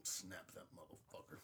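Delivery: whisper. Acted. Joking threat whispered.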